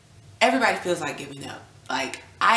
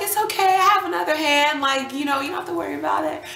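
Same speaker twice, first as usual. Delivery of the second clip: much higher, louder, sweet imitated nurse; reassuring nurse; 10 semitones higher, 6 dB louder, faster than usual. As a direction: play the upbeat nurse comforting her patient. Nurse reassuring her during stitches.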